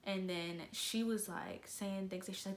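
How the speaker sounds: ordinary delivery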